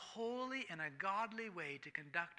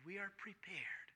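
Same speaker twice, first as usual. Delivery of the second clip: preacher's whisper; 3 semitones higher, 7 dB quieter than usual. Real, not acted. Preacher whispering to the congregation, hushed. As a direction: drop to a hushed, breathy whisper. Preacher drops to intimate aside.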